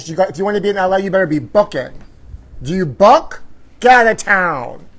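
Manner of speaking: loud, sassy diva exclamation; sassy drag queen. Acted. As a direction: throw shade with full sass.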